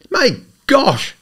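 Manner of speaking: high, loud startled exclamation; jolted with sudden excitement; electrified cry. A narrator's reading. Freddie hearing Eve stole the necklace, electrified cry. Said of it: "cried Freddie, electrified".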